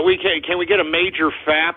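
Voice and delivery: usual speaking voice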